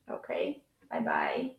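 ordinary delivery

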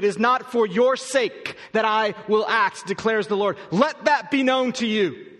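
scripture reading. Acted. Pastor reading verse thirty-two aloud, slow and weighty. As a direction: weigh every word of the verse.

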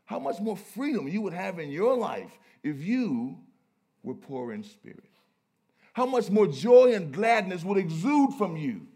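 loud, high preacher's proclamation; exultant pulpit cry; full-force preaching. Acted. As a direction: ring it out to the whole congregation.

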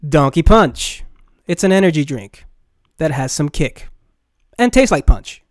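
loud, punchy sponsor pitch; loud ad read. Acted. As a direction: sell the energy drink loudly.

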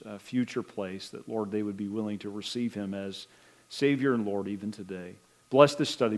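soft, hushed prayer voice; bowed-head closing prayer to the Lord. Real, not acted. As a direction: pray quietly and reverently. Closing prayer before the study.

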